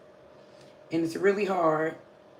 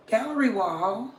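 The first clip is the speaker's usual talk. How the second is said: ordinary talk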